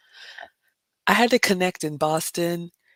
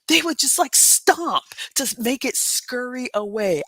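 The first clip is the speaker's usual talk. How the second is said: higher and louder, animated; excited demonstration; 6 semitones higher, 3 dB louder than usual. Retold or imitated. Showing viewers how people shooed the rat, excited. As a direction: act out the stomping with energy.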